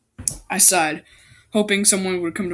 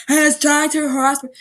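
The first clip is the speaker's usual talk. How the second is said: higher, whinier repeat of He's trying to harass me; mock outrage; 7 semitones higher, 5 dB louder than usual. Macy repeating her complaint with hammed-up outrage. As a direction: ham up the complaint.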